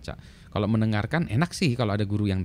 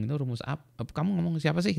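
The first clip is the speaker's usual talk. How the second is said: higher, puzzled asking; confused listener; 7 semitones higher than usual. Retold or imitated. Colleague puzzled by Feynman's formula name, higher voice. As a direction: voice a baffled colleague. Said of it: "orang lain bingung".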